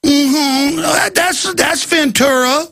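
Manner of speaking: mock leering. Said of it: "We're going".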